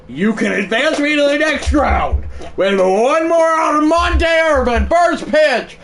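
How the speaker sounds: huge high shouted sportscaster call; triumphant broadcaster yell; play-by-play call. Acted. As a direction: belt it out like the winning call on TV. Calling the final out live.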